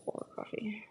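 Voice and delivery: quiet whispered note; whispering. Real, not acted. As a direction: whisper it to yourself. Unvoiced aside.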